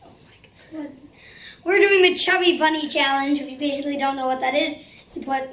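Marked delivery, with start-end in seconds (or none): 0.0-0.9 breathless whispered oh my God; whispered aside. Real, not acted. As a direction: whisper it off to the side. Aside while mom greets viewers.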